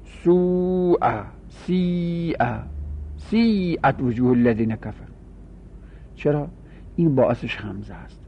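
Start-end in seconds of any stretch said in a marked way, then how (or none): 0.0-2.4 سوء drawn out very slowly, then سیء, both softer; Arabic recitation. Shown as a demonstration.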